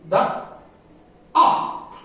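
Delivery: light "The", pause, then punched "up"; two separate beats with "up" stressed. Shown as a demonstration. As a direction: hit "up" after an unstressed "The". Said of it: "The unstressed".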